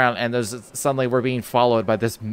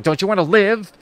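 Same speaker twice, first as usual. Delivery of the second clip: a louder, mildly mock-angry retort; 8 semitones higher, 6 dB louder than usual. Retelling the angry stranger in the night, mock anger. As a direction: imitate him getting worked up. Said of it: "he was getting really angry".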